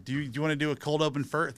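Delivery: normal talking voice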